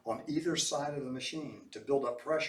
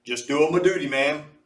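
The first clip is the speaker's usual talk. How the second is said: hearty hero; 2 semitones higher, 8 dB louder than usual. A narrator's reading. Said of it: "Mose said".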